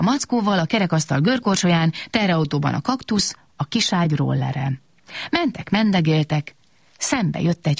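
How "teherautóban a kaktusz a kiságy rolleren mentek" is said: usual speaking voice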